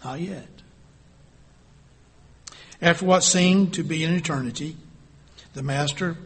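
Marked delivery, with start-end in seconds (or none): 0.0-0.6 soft, plain reply; quiet and unhurried; simply. Acted. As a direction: potter simply answering the teacup, soft. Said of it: "simply said".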